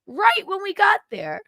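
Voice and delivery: high squeal of delight; high, giddy repeat; mock excited squeal. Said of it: "I was like".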